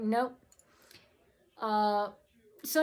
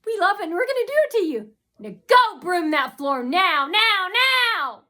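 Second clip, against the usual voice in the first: bossy taunting shout; 7 semitones higher, 7 dB louder than usual. Retold or imitated. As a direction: bark the orders at them. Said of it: "And then go on and say".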